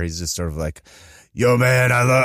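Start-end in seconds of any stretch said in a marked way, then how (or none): 1.3-2.2 lightly imitated rapper voice; half-hearted go at a gruff rapper, given up midway. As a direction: loosely mimic a low-register rapper. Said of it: "in the really lower register".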